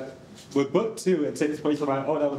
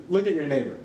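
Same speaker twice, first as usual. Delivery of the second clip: mock preacher call; faster than usual. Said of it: "leading crowd".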